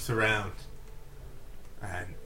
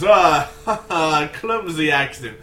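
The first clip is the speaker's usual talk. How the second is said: loud, hearty regal laugh; booming, jovial dismissal; regal brother; 4 semitones higher, 10 dB louder than usual. Acted. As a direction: laugh it off grandly. Regal figure laughs off crash.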